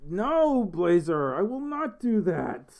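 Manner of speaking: high, drawn-out protest; emphatic refusal. Real, not acted. Streamer turning down Blazer's request in chat, emphatic refusal.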